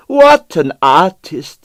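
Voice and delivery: effusive praise. Acted. Pavel extolling dead dancer.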